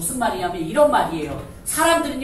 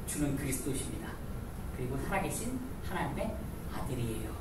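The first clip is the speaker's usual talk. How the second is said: soft confession; 7 semitones lower, 13 dB quieter than usual. Acted. Peter's confession retold.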